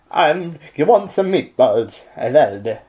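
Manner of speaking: put-on a Swedish dwarf offering meatballs, in an Italian accent; Italian accent meant as Swedish. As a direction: try for Swedish, slip into Italian. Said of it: "you're still doing an Italian accent".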